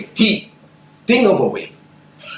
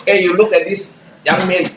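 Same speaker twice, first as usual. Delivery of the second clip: normal talking voice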